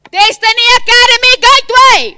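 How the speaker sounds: full-throated rallying scream. Real, not acted. Principal rouses sleepy students with a scream. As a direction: roar the school name at them. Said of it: "Sorry for screaming over the mic".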